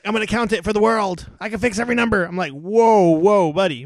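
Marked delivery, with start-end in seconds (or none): 0.0-2.2 higher, excited imitation of a braggart; mock boasting. Host imitating a boastful podcast guest, mock-excited crowing. 2.5-3.8 alarmed mock halting. Host calling a halt to boastful guest, loud and alarmed.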